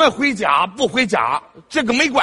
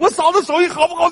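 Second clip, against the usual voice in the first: higher and louder, mock indignant; 我嫂子手艺好不好 said higher and louder the second time; loud repeat; 7 semitones higher, 3 dB louder than usual. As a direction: punch the repeat as a comic retort. Repeats the question, much louder.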